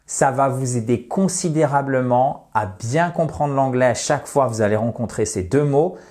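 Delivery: ordinary talk, nothing marked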